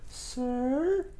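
put-on high, thin little girl asking "Sir?". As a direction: squeak it like a small girl in the dark. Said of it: "It's like a little girl voice".

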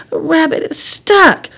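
high-pitched worried lament; dismayed. Acted. Girl looking into the log, dismayed. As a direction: fret over the trapped animal.

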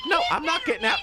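high, stubborn mock granny; old lady voice. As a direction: refuse like a cranky old woman.

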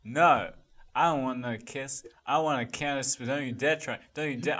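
usual speaking voice

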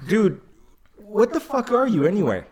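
high, mock-tough challenge; imitating tough kid. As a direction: play the scrappy kid squaring up. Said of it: "he's like".

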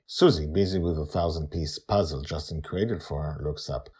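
ordinary delivery, nothing marked

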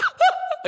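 a burst of high, real laughter. Real, not acted. Preacher laughing at himself mid-story. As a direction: laugh out loud at the memory. Laughing at his own excitement.

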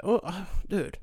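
usual speaking voice

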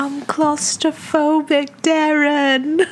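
high, slow British reality-star impression; Gemma Collins. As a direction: draw it out as a hammed-up diva complaint. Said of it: "exactly a thing that Gemma Collins says".

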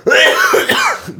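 high, hammed-up fake cough. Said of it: "I'm gonna cough over you".

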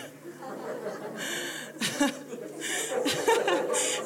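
long breathy fit of laughter; laughing. Real, not acted. Preacher cracking up at her congregation, laughing. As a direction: laugh helplessly, mostly breath.

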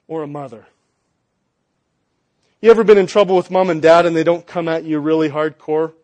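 ordinary delivery

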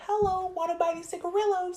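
put-on high, soft childlike ask; innocent kid voice. Baby-faced girl asking clerk for cigarillos, childlike.

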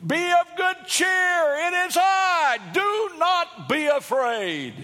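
high, drawn-out shout across the water; very loud holler. Acted. Preacher as Jesus calling to the disciples in the storm, hollering. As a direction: holler it back to them, slow and full-voiced. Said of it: "he hollered this back to them very loudly".

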